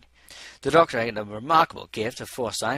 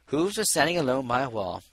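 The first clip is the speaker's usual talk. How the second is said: ordinary delivery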